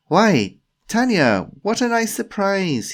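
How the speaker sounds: loud, high, overdone greeting; feigned delight. Acted. Timothy greets Tanya he hoped to avoid, feigned delight. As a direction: act pleasantly surprised, high and loud.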